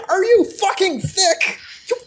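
very high, incredulous outburst; exasperated scolding. Acted. Player berating the teammate who fired, high-pitched exasperation. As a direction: tear into a reckless teammate.

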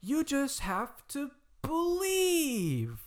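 high, slow, dreamy mock believer; drawn-out high sappy plea; mocking the grown-ups' faith; mock earnest adult. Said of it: "they're like".

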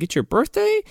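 high-pitched surprised question; mock surprise. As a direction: imitate her astonishment, voice jumping up. Voices Chi-Chi surprised at birthday news.

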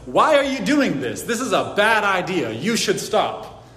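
imitating an indignant Peter. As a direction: voice Peter's shocked objection to his master. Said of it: "can you hear the indignance in Peter's voice".

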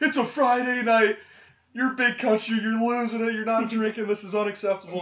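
high, loud imitation of hecklers; mock heckling. Friends ribbing him for staying home on Friday, mock heckling. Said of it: "were heckling the shit out of me".

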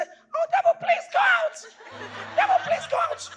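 very high, whiny begging; pleading falsetto. Retold or imitated. Preacher mocking a believer begging the devil. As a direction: beg the devil in a silly high voice. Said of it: "I'm gonna say".

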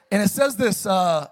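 ordinary talk, nothing marked

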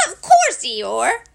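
squeaky little character voice; eager Piglet. Acted. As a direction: squeal the assurance. Piglet eagerly assures Eeyore.

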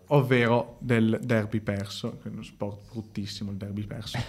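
low, quiet and dejected; gloomy after a lost derby; sad. Real, not acted. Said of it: "sono tristissimo".

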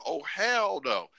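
high, slow drawn-out no; emphatic refusal. Acted. Man refuses South Africa over snakes, emphatic refusal. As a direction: stretch each word in comic protest.

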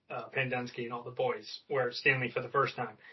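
usual speaking voice

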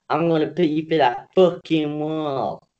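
quoting the line in a put-on Boston accent; lightly voiced Boston tough guy. Host quotes the cartoon character in a Boston accent. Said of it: "That's my Boston accent".

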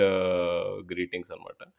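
ordinary talk, nothing marked

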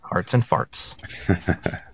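slow breathy whisper; whispered sign-off. Acted. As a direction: breathe it out slowly.

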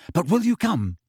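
high, pressing question; urgent. A narrator's reading. Hermione pushing Harry for an answer, urgent. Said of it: "said Hermione urgently".

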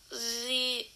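ordinary talk

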